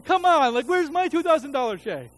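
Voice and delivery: loud, high, indignant whine; jealous complaint. Retold or imitated. Pastor in the pew grumbling at God, loud and indignant. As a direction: demand your share, aggrieved. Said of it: "that was my response".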